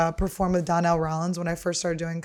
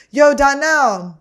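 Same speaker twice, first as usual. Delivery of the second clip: higher, louder, drawn-out call; 7 semitones higher, 7 dB louder, much slower than usual. Retold or imitated. Friend calls the host over at a party, calling out. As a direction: imitate a friend hollering for someone.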